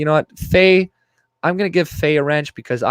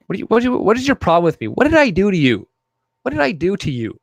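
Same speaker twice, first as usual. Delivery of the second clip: exasperated; 8 semitones higher, faster than usual. Real, not acted. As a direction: throw up your hands at a troll. Exasperated at troll in chat.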